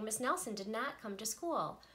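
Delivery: plain reading voice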